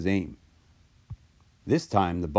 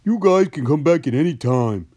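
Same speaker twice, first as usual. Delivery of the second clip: higher, louder storybook giant; happy giant; 5 semitones higher, 5 dB louder than usual. A narrator's reading. Giant welcomes the visitors back, happy and loud. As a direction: boom out a happy invitation. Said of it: "said the giant happily".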